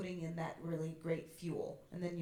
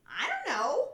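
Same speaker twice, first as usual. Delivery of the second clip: higher, louder I don't know; 8 semitones higher, 10 dB louder, slower than usual. Shown as a demonstration. Teacher stepping the phrase higher, louder. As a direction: lift it up with more push. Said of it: "Now say".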